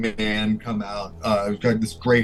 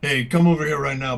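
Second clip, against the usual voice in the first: higher, stern guard order; 3 semitones higher, 3 dB louder, faster than usual. Acted. Guard orders the woman out, a hint of sternness. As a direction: call her over firmly. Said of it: "he's just like".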